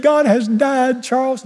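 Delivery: slow, high mock wail; upset wife. Wife breaking the news to Charles, mock upset. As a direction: imitate a distraught wife, drawn out and high. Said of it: "She's upset".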